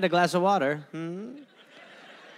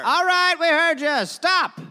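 fed-up retort; 8 semitones higher, 4 dB louder than usual. Acted. Fed-up reply to the rescuer.

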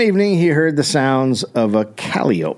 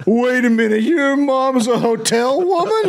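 high mock gasping disbelief, higher pitch; mock shocked; 11 semitones higher than usual. Friend gasping over a hotel-working mom in mock shock. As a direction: gasp like a scandalized gossip.

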